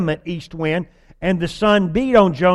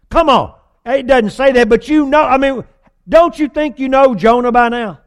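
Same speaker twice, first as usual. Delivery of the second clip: higher and louder, come-on disbelief; exasperated appeal; 5 semitones higher, 5 dB louder than usual. Real, not acted. Preacher exasperated at Jonah, pitched up and loud. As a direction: plead with them to see it.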